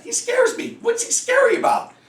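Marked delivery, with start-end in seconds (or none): 0.0-0.8 mock scared. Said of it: "I have neighbors that say that".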